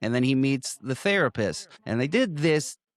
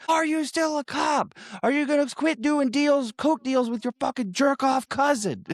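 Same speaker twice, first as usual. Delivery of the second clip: much higher mock-furious shouting; retold furious interrogation; 13 semitones higher than usual. Gangster grilling the man he smashes, high mock fury. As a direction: imitate a man yelling questions mid-beating, pitched way up.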